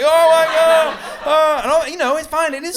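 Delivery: very high, loud frantic cry; stressed-out panic. Acted. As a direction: wail like someone wired on too much espresso. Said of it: "had way too many espressos".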